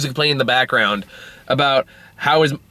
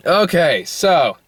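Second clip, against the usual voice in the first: ordinary talk, nothing marked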